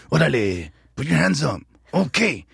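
high-pitched English-accented attempt at a gang line; mock a miscast actor's English-accented tough talk. Actor auditioning as Chewie the gang member, English accent. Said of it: "I had an English accent".

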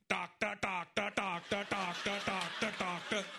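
dad's indicator noise. Retold or imitated. Comedian imitating his dad making a clicking blinker noise. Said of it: "where my dad screws up the sound".

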